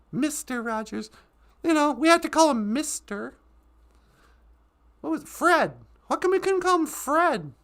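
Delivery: normal talking voice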